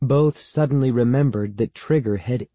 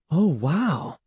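higher, drawn-out wow of awe; amazed exclamation; 6 semitones higher than usual. A narrator's reading. Andy staring into the bucket, amazed exclamation. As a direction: let the wow swoop up and down in amazement. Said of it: "Andy exclaimed".

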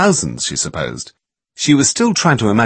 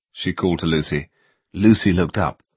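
ordinary delivery, nothing marked

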